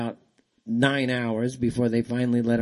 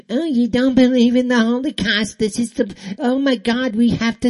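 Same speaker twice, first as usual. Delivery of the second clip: much higher and louder, hammed-up alarm; mocking panicky voice; 10 semitones higher, 6 dB louder than usual. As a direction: parody a shocked, panicking critic. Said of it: "mocking the critics".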